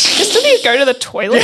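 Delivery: hammed-up desperate impatience; mock exasperation. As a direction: act out pleading to get away. Acting out her trapped impatience.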